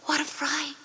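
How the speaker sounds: breathless scared whisper; frightened whisper. A narrator's reading. Witches staring at the swelling dough, frightened whisper. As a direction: whisper it in sudden fear.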